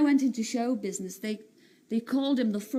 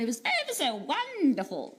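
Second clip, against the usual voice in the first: posh accent; 3 semitones higher than usual. Shown as a demonstration. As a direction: gush in a mock-posh accent. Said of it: "talking like that".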